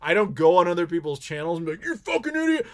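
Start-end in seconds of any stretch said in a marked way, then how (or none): 1.8-2.7 high-pitched put-on insult; mocking high voice; mock troll. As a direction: fling it like a cartoonish troll, high. Mimics starting drama on channels.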